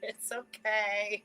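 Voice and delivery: slow soothing reassurance. Shown as a demonstration. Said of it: "repeats soothing line".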